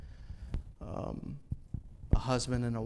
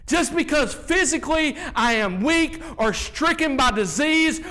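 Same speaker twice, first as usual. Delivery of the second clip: much higher and louder, preaching at full force; fiery preaching; 9 semitones higher, 10 dB louder than usual. Acted. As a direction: drive the message home from the pulpit.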